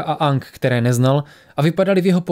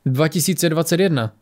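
usual speaking voice

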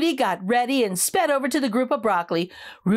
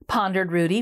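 ordinary delivery, nothing marked

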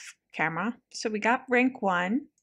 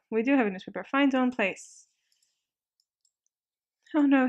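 normal talking voice

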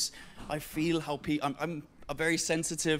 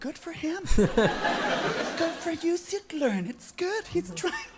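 condescending encouragement; 9 semitones higher, slower than usual. Retold or imitated. Audience member watching a bad pianist, patronizing coo. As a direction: coo kindly at a struggling beginner. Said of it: "you're like".

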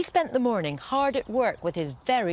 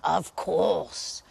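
lightly growled, a touch lower; important growl; 3 semitones lower than usual. Acted. Wolf boasts to the giant, lightly growled. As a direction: sound self-important, like a proud wolf. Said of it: "growled the wolf importantly".

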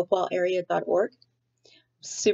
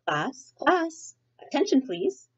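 higher teacherly call for attention; calling class to order; 9 semitones higher, slower than usual. Acted. Principal quieting the classroom, a high call for attention. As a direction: get the room's attention, pitched high.

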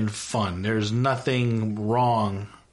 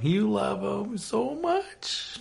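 slow, soft, higher teasing singsong; teasing drawl; 9 semitones higher, 4 dB quieter, much slower than usual. Acted. Vlogger teasing viewers about loving the band, slow soft singsong. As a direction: tease them slowly and knowingly.

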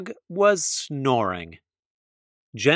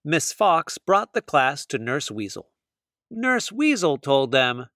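plain reading voice

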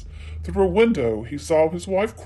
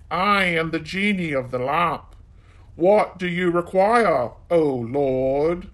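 genie of the lamp; 4 dB louder, slower than usual. A narrator's reading.

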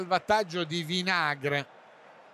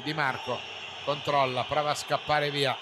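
ordinary delivery

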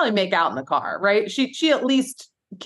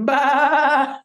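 higher, drawn-out "baah"; mock goat cry; goat bleat; 5 semitones higher, 3 dB louder than usual. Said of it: "he's like".

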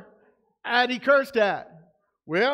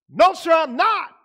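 indignant outburst, higher and louder; raised-voice, emphatic protest; angry denial; 5 semitones higher, 6 dB louder than usual. Acted. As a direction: snap back in angry denial. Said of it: "he replied angrily".

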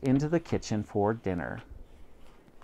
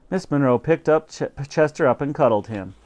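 usual speaking voice

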